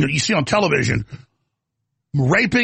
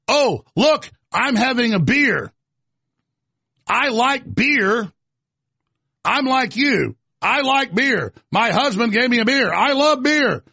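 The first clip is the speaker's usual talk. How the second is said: much higher, eager fake-folksy voice, slow in places; mock Elizabeth Warren; 7 semitones higher, slower than usual. Mocking the senator on camera with her beer, fake-folksy. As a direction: overplay a politician trying to seem relatable. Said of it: "Elizabeth Warren".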